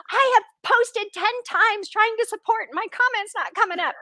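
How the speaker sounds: high, loud mock complaint; frustrated commenter. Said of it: "I've been on that other end going".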